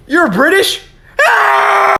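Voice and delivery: high, loud shrieking panic; terrified scream. Acted. Kid screaming at the British ghost, loud and high. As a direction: scream it in mock terror.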